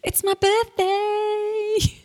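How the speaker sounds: high, slow sing-song birthday cheer; excited. Real, not acted. Announcing her own birthday.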